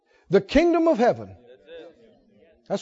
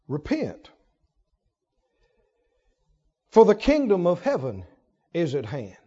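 scripture reading; 6 semitones lower, 3 dB quieter than usual. Acted. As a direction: read it like holy writ. Quoting scripture solemnly.

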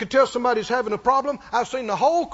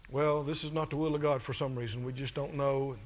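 much softer, lower, resigned mock excuse; mock resigned shrug; 11 semitones lower, 11 dB quieter than usual. Mocking a doubter's excuse, softly resigned. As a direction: drop the voice into a limp, hopeless excuse.

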